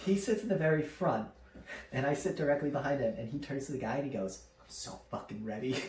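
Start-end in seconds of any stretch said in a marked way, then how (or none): 4.6-5.8 low, soft mock-intense voice; quietly psyched. Brother to the guy before the ride starts, quietly psyched. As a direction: imitate brother's hushed readiness.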